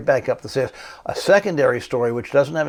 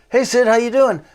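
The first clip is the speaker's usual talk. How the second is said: higher, louder breezy greeting; 11 semitones higher, 6 dB louder than usual. Retold or imitated. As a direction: toss it off light and friendly like a star putting you at ease. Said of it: "He says".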